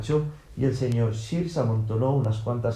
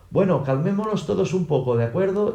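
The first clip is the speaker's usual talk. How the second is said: high raised voice over a quarrel; pleading shout; 7 semitones higher than usual. A narrator's reading. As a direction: call for calm, pitch climbing at the end. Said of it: "Y el señor Shears gritó".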